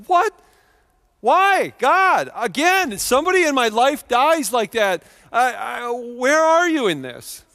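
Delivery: high, loud protest to God; shouted, pleading anguish; anguished outcry. Retold or imitated. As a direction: cry out in shocked disbelief, demanding an answer. Acts out anguished cry to God.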